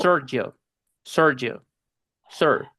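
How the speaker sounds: "Sergio" as English SUR-jo, the E swallowed. Shown as a demonstration. Teacher shows how gringos say his name, E dropped. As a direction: say "Sergio, sir" with an English UR vowel. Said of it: "se pronuncia como si no tuviera la E".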